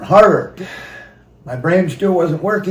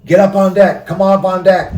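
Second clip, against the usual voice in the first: retold scream; 4 semitones higher, 7 dB louder than usual.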